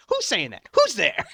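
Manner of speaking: loud, high imitation of a manager; hammed-up manager's shout; demanding manager. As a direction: call out to find the culprit. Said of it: "the manager came over and goes".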